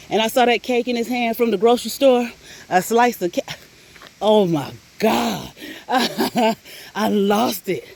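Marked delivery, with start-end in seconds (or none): 4.2-7.9 laughing. Real, not acted. Laughing at the wrong cake.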